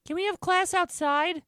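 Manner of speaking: put-on very high, slow child's voice; hopeful pupil. A narrator's reading. Pupil Tessa asking teacher for class outside, hopeful. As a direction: plead like a little kid, high and drawn out.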